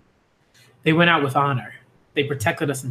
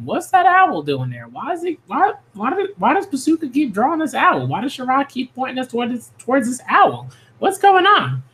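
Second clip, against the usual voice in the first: puzzled fans; 8 semitones higher than usual. Retold or imitated. Fans asking why the artist keeps drawing the owl, in a high, baffled voice. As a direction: play up the confusion of the readers. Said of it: "And we were like".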